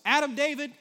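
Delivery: loud, high call of a mother from another room; mom calling out. Retold or imitated. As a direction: yell the full name like mom about to scold.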